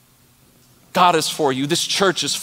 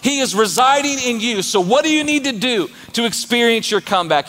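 full-force preaching; 8 semitones higher than usual. Acted. Pastor rallying the congregation, preaching at full force. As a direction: preach it hard to the crowd.

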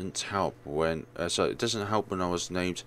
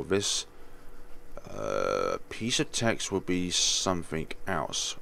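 ordinary delivery

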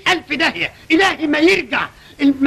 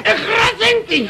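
angry order; 8 semitones higher, much slower than usual. Acted. Silencing the other sharply.

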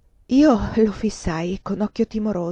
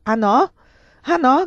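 loud, raised, taunting challenge; angry cry; 6 semitones higher, 7 dB louder than usual. A narrator's reading. Lover scorning the countess's protest, angry cry. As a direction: fling her denial back louder. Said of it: "gridava lui".

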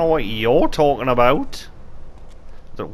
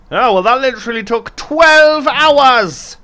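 exasperated outburst; 10 semitones higher, 9 dB louder, slower than usual. Real, not acted. Exasperated at the game.

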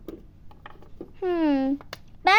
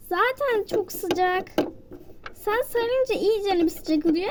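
ordinary delivery

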